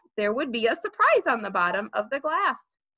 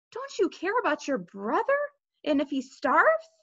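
higher-pitched appeal of an upset mother; reproachful pleading; 6 semitones higher than usual. A narrator's reading. Mother asking Peter about his brother, reproachful plea. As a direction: question him in pleading reproach. Mother guilt-tripping her older son.

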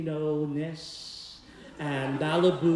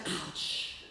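quiet ouch; 2 semitones lower, 7 dB quieter than usual. Retold or imitated. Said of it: "And she said".

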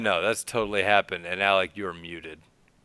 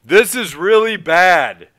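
loud, slow, booming worried lawyer; no inside voice; 10 semitones higher, 12 dB louder, slower than usual. Retold or imitated. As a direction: blurt it out way too loud. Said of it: "a lawyer with no in-, like, no inside voice".

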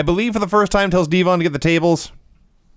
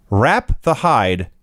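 slow, drawn-out recitation of a silly rhyme; mock poem recital; 3 semitones lower, much slower than usual. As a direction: recite it like solemn verse. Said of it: "recites a poem".

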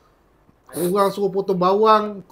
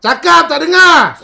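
angry scolding; 8 semitones higher, 13 dB louder, slower than usual. Acted.

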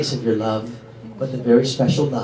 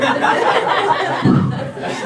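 laugh. Real, not acted. Laughs at couple.

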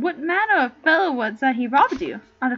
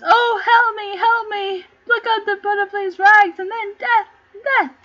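loud, higher desperate pleading cries; crying for help; 6 semitones higher, 6 dB louder than usual. Acted. Rogue on the ground calls to passing clown, loud desperate plea. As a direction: beg loudly for help like a beaten man.

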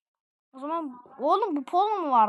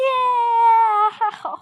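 sudden burst of loud, high laughter; 7 semitones higher, 7 dB louder than usual. Real, not acted. Gamer laughing at a ragdoll fall, loud and high.

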